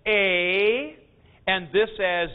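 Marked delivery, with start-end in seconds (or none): 0.1-1.0 a drawn out as "ay"; alternative pronunciation. Shown as a demonstration. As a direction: say a as a long, rising ay. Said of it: "instead you pronounce this as".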